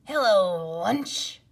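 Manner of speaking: loud menacing snake. Acted. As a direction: greet them like a hungry snake. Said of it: "said the snake".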